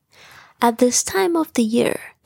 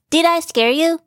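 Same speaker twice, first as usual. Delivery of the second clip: higher, loud playful question; playful tease; 7 semitones higher, 3 dB louder than usual. Acted. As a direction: tease them after the scare. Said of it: "tried to scare his buddies".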